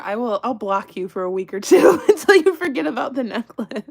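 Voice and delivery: cracking up mid-quote; laughing. Real, not acted. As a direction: lose it to laughter as you quote. Laughing through quoted friend.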